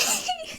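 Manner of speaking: very high, tearful squeal of laughter; half laugh, half cry; helpless exasperated squeal; frustrated laugh. Real, not acted. Exasperated at a character.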